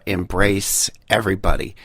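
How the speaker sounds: usual speaking voice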